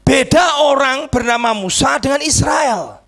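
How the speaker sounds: high, emphatic proclamation; forceful preaching. Real, not acted. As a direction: proclaim it to the whole congregation.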